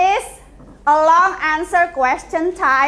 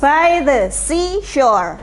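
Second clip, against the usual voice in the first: slow phrase for learners to copy; measured tongue twister drill; repeat-after-me model; slower than usual. Shown as a demonstration. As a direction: model it slowly, word by word. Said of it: "You can repeat after me".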